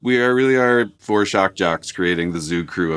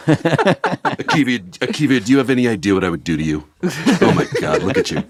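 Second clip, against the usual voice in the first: sleazy shock jock; 3 semitones higher than usual. Pretend. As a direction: ham up a creepy radio host. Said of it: "sexually harassing each other".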